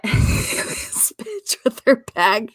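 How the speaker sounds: wheezing through laughter; barely getting words out while cracking up; laughing. Real, not acted. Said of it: "Why are you laughing?".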